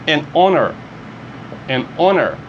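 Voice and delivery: honor with a silent H, starting on the vowel; English pronunciation. Shown as a demonstration. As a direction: drop the H in honor. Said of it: "Aqui você lê". (honor sem pronunciar o H)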